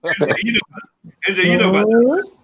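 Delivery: loud, high mock challenge; teasing call-out. Real, not acted. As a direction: call him out loudly, teasing.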